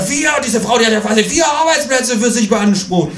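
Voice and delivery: high, loud indignant shouting; outraged shout. Acted. Freundlich outraged at the Chinese, high loud indignant yell. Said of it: "ruft er".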